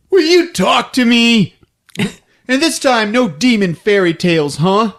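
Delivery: high, loud, coaxing cop voice; pushy detective. Retold or imitated. Detective pushing Kirsty to stop telling demon stories. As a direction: press her for a straight answer. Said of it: "the canned ham is like".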